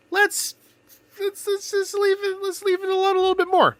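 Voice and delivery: high, coaxing plea to hold off; high pleading voice. Acted. Pleading chat to let it wait.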